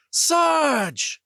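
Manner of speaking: anguished cry. Acted. Pilot crying out as her sergeant is blown up, anguished. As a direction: cry out as his ship explodes.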